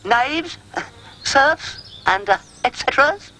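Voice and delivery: high, pompous proclamation; grand announcement. A narrator's reading. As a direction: address the serfs like a lord. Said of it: "he began".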